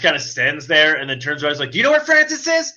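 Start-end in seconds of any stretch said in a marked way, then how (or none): 1.7-2.7 higher, taunting sing-song call for Francis; mock Deadpool. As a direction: tease him as you hunt. Imitating Deadpool hunting Francis.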